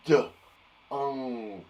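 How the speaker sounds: D then unk, split apart; sounded out. Shown as a demonstration. Teacher sounding out dunk for pupils. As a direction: stamp the two parts out. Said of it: "stamp on those sounds".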